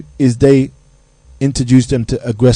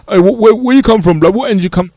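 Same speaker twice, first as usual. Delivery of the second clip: mocking road-man challenge; 5 semitones higher than usual. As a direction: sneer it like a youth picking a fight. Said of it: "people's picking you on a thing like".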